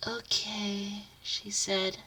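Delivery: very soft gentle okay; soft relaxed okay. Acted. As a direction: breathe it out calmly. Relaxed smile.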